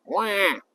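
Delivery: a mock infant wail; quick pretend baby cry. Baby crying imitation.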